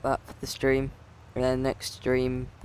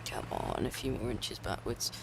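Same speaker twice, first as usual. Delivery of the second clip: under breath; 2 semitones lower, 9 dB quieter than usual. Real, not acted. Coaxing the tractor under breath.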